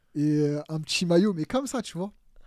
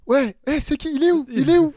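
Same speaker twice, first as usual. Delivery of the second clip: higher, louder demanding call; 12 semitones higher, 12 dB louder than usual. Retold or imitated. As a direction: shout for the new player. President arriving, calling out.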